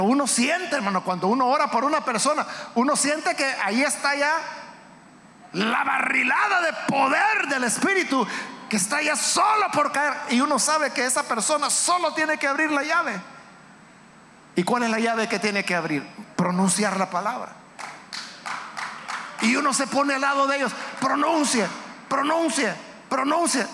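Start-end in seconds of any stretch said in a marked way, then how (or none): 1.0-13.2 raised, higher-pitched sermon delivery; impassioned preaching. Real, not acted. Preaches at full force. 21.0-23.6 urgent coaxing. Acted. Preacher acts out urging the person.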